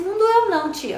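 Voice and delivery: put-on high little boy; child's voice. Therapist retells the boy's reply, high child voice. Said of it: "Ele falou assim".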